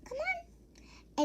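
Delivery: high sing-song call to the dog; coaxing the dog. Retold or imitated. Girl coaxing her dog into the basement, high sweet call. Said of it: "I was like".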